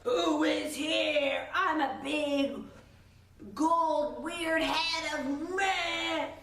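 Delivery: slow, loud, drawn-out giant head voice; booming wizard. Retold or imitated. The Wizard greets visitors, slow and loud. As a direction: intone like a great hidden head. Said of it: "he's like".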